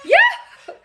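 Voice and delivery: high, loud burst of delight; joyful squeal. Real, not acted. Host thrilled after reading the story, squealing with joy. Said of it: "I'm laughing and wiggling with joy".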